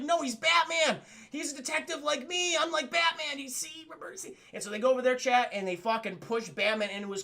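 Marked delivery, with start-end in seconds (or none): 0.0-4.4 put-on much higher excited boy; high kid voice; eager kid voice. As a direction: pitch it up like an earnest kid. Kid insists on helping Batman.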